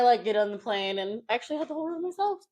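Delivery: normal talking voice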